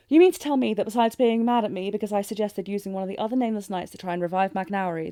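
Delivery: ordinary talk, nothing marked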